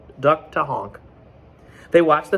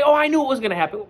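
mock know-it-all; 12 semitones higher, 5 dB louder, faster than usual. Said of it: "You can say".

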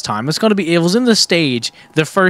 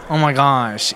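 ordinary talk

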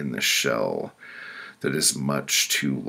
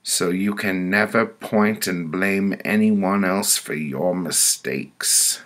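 stern pronouncement, pitched up; higher-voiced woman passing judgment; committee woman; 4 semitones higher than usual. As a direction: hand down the verdict as the judging woman.

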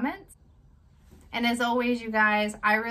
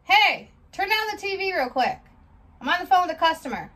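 higher, louder shout off the phone; yelling at kids mid-call; 9 semitones higher, 6 dB louder than usual. Acted. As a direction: bark at the kids to turn down the TV. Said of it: "that is not a good way to handle a conversation".

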